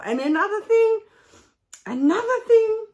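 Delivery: indignant rant. Real, not acted. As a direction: launch the next complaint loudly. Ranting, raising new point.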